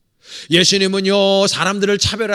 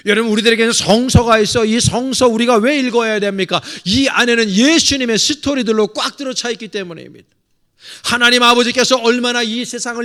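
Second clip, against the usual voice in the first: loud, rousing pulpit delivery; full-force preaching; 7 semitones higher, 8 dB louder than usual. Acted. Pastor exhorting his congregation, loud and rousing. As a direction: preach it out to the pews.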